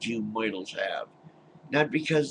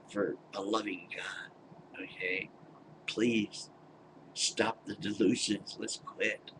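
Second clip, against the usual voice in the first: soft plea; 4 semitones lower, 8 dB quieter than usual. Real, not acted. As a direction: plead gently and quietly. Pleading gently to parents.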